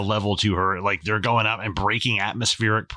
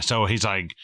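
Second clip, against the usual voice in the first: ordinary delivery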